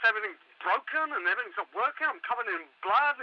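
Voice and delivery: frantic, rambling, pitch jumping up; panicked caller. Acted. Caller trapped in the mall telling the host, panicked rant. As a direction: gabble it out, scared out of your wits. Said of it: "I can hear it in your voice".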